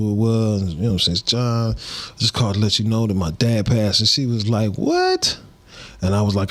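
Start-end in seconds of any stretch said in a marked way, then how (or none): 4.7-5.4 sudden high shocked what; the old aunt can't believe the news; aunt's shocked what. Retold or imitated. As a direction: jump up high in disbelief. Aunt hearing the sad news.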